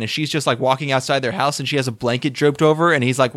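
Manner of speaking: usual speaking voice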